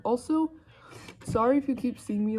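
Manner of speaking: ordinary delivery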